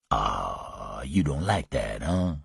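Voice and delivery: low, soft mocking taunt; Louis, taunting. Louis goading the gunpowdered man, low and soft. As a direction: needle him quietly.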